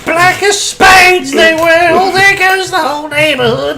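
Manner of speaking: very high falsetto Python crone; comic high-pitched old lady; Monty Python old lady. Retold or imitated. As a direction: quote it in a squawking mock old-woman voice. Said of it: "turn this into nothing but quoting, like, Monty Python".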